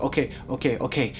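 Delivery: soft words between panicked breaths; hyperventilating. Retold or imitated. Scared lady waits for security, softly hyperventilating. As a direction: pant it out, short of breath. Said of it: "literally hyperventilating".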